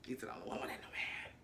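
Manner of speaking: a hushed, confiding whisper. Real, not acted. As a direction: lean in and whisper the gossip. Whispered gossip aside.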